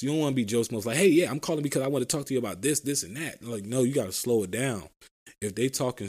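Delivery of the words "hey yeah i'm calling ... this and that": fast, rushed sales pitch; hurried mock pitch, tumbling out; rushed caller. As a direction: rattle it off like a pushy caller. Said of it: "You don't wanna be talking all fast".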